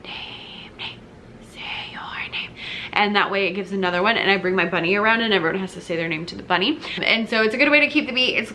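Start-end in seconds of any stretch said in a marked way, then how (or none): 0.0-2.5 slow whispered chant. Shown as a demonstration. Music teacher modelling the name game in whisper voice, whispered chant. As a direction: whisper the chant on a steady beat. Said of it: "when we learn to whisper".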